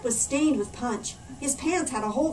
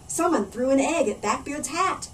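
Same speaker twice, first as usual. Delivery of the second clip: ordinary delivery, nothing marked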